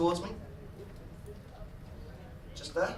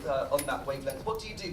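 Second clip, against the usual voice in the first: ordinary delivery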